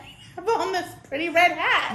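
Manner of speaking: laughing. Real, not acted. Laughing while describing hat.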